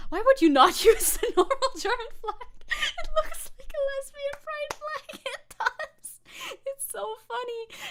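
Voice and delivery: high squealing laughter through the words; laughing. Real, not acted. Streamer cracking up at the flag, laughing high.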